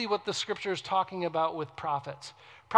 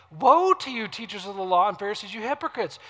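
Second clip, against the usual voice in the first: scripture rebuke; 2 semitones higher, 6 dB louder than usual. Acted. Preacher voicing Jesus' rebuke to the Pharisees, forceful reproach. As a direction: read the woe as a raised-voice reproach.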